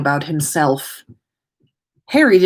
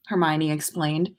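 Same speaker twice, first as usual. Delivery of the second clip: usual speaking voice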